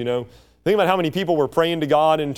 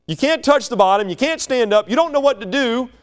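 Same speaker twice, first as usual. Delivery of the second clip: normal talking voice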